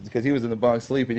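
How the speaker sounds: ordinary delivery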